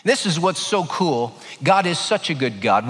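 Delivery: ordinary talk, nothing marked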